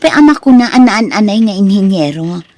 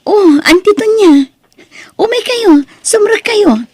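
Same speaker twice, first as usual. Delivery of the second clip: much higher, bright cry of surprise at the aunt; surprised greeting; 11 semitones higher than usual. Acted. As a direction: gasp and welcome her in. Surprised niece greets her aunt.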